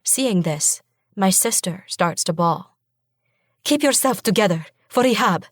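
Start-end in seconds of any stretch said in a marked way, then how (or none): 3.6-5.5 firm demand. A narrator's reading. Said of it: "I demand".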